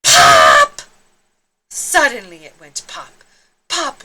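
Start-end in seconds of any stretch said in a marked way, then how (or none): 0.0-0.8 sharp shouted pop sound; one big explosive pop; loud pop. Acted. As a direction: burst the balloon with your voice. Bandit bursts.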